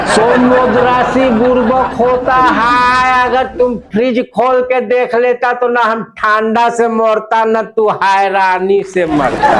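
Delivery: put-on laughing Bengali-accented Hindi; mock the friend in a Bengali accent. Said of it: "तब बंगाली बाबू कहते".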